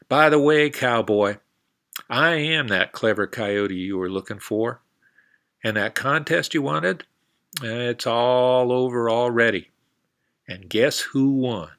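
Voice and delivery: Coyote calling back. Acted. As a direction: call back over your shoulder, triumphant.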